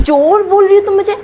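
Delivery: high, loud, offended retort; outraged. Acted. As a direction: throw the accusation back in outrage. Aunty outraged at theft accusation.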